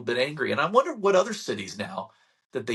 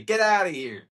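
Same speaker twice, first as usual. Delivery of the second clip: higher, punchy Italian "Get outta here"; 5 semitones higher, 3 dB louder than usual. Retold or imitated. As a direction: fling it out, Italian style. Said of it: "as the Italians would say".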